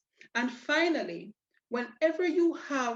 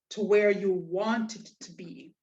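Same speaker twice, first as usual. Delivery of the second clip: normal talking voice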